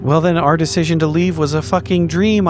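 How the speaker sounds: usual speaking voice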